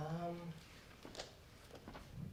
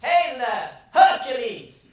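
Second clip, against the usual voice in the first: calling out; 4 semitones higher, 13 dB louder than usual. Acted. Banging on Heracles' door.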